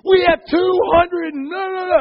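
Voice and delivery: put-on high, loud, breathless kid blurting news; excited kid. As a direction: burst in like an overjoyed teenager. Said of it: "and he goes".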